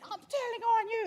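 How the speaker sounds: high, loud outburst as an upset sister; mimicked high-pitched telling-off; imitating outburst. Sister bursts in, quarrel retold.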